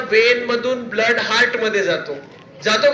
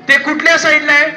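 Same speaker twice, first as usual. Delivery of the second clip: higher, louder question to the class; pressing question; 4 semitones higher, 5 dB louder, faster than usual. Real, not acted. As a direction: demand the answer from the class. (वर्गाला मोठ्याने विचारलेला प्रश्न)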